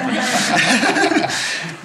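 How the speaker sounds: loud laugh at himself; amused real laughter. Real, not acted. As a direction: laugh off the joke. Laughs after accent joke.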